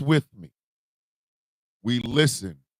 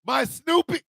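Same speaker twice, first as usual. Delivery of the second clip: "My Snoopy" lifted higher and drawn up at the end; higher repeat; 9 semitones higher than usual. Retold or imitated.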